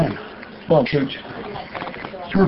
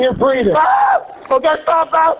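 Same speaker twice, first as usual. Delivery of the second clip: sharp pained outcry; pained yell; 9 semitones higher than usual. Real, not acted. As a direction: cry out in pain. Tased man cries out.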